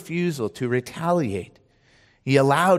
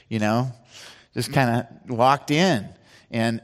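ordinary talk, nothing marked

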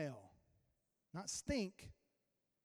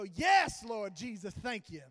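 exuberant praise shout; 10 dB louder, slower than usual. Retold or imitated. Group praying over a waitress, shouted praise. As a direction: explode on the first word, then settle.

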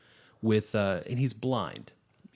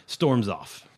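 normal talking voice

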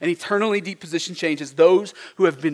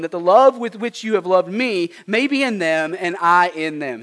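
high, loud proclaiming read; scripture reading; 4 semitones higher, 7 dB louder than usual. Acted. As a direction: proclaim the verses to the congregation. Reads scripture aloud.

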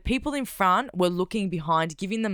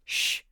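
retold shush. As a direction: hush them with a short shh. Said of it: "And Costa's like".

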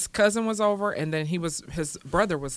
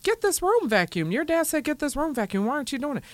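high scolding voice; 7 semitones higher than usual. Retold or imitated. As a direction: voice jumped way up, scolding the kid. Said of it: "So I go".

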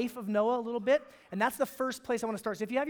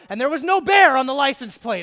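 much louder and higher, crowing the punchline; triumphant reveal; 5 semitones higher, 15 dB louder than usual. Retold or imitated. Pastor hitting the story's punchline to the church, much louder and triumphant. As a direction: belt it out like you just won the argument.

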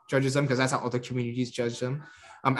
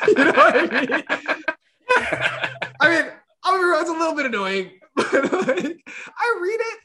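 talking through real laughter, pitch high; laughing; 5 dB louder than usual. Real, not acted. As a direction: giggle your way through the rant. Laughing while ranting about parents.

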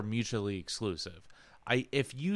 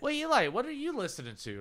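much higher quoted question; high voice of an older cousin asking the kid; lightly voiced cousin; 12 semitones higher, 4 dB quieter, faster than usual. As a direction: ask it in a pitched-up imitation. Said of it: "It's like".

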